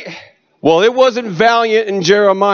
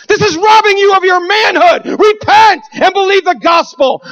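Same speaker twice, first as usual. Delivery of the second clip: street preaching shout; 10 semitones higher than usual. Retold or imitated. Son preaching from the van roof at escorts, shouting. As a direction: call out the escorts hard, full force.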